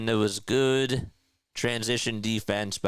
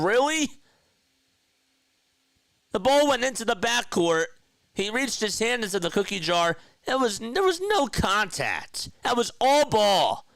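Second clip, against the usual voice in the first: high, loud protest at the ref, slowing down at the end; outraged disbelief; 8 semitones higher, 3 dB louder than usual. Real, not acted. Fan yelling at the referee over a bad foul call, loud outraged protest.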